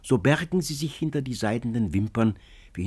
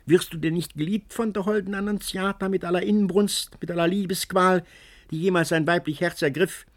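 higher crone's voice; old crone; 8 semitones higher than usual. A narrator's reading. Old woman assures Antonio of love, high crone voice. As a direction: sing it out like a crone. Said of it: "nach ihrer gewöhnlichen Art".

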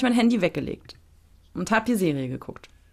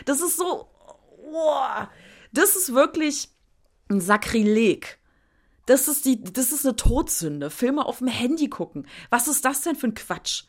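mock outrage; 4 semitones higher than usual. Mock outrage about phone films.

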